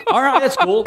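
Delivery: laughing through the words, voice jumping high; a disbelieving laugh while agreeing; giggling out a mock okay. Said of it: "I was like".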